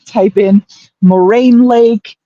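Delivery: slowly spelling out names; slow and clear. Shown as a demonstration. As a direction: give each place name slowly. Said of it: "If anyone is interested in looking it up".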